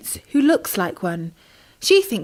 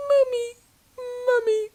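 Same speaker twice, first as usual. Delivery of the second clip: high, tearful begging; little tadpole crying and pleading; sobbing plea; 13 semitones higher, slower than usual. Acted. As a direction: beg your mum through tears. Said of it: "heard little Tim's sobs".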